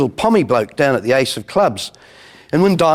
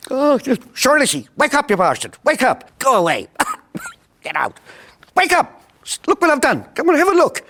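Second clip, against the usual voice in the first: excited Irish feller; 10 semitones higher than usual. Retold or imitated. Irishman wakes his mate to see the Guinness, high and excited. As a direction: shake your mate awake with glee. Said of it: "Irish fellers".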